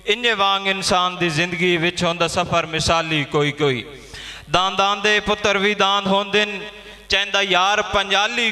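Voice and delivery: high, sustained verse declamation; poem recitation. Acted. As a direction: recite it to the whole gathering. Poet recites verse at gathering.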